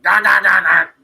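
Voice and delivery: loud, high gah-gah babble; mock babbling. Mocking how the couple talks, loud babbling. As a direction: yap it like nonstop chatter. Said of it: "talking like this".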